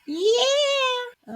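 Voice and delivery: long, high yeah falling at the end; excited cheer. Real, not acted. Cheers the spinner's result.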